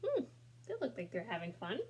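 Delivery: ordinary delivery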